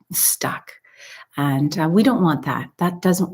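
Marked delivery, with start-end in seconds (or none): 0.1-0.7 whisper. Real, not acted. Whispered word for stuck back.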